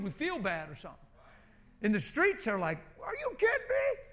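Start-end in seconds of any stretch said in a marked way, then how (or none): 3.0-4.0 very high squeaky imitation of astonishment; mock disbelief. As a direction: mimic a stunned stranger in falsetto. Imitating astonished people on street.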